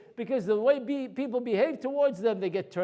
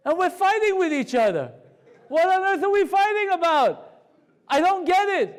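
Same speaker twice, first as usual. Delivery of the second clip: loud, high, exasperated outburst; raised voice, pleading for sense; 8 semitones higher, 6 dB louder than usual. Retold or imitated. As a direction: protest loudly in bewilderment at the infighting. Exasperated plea about infighting.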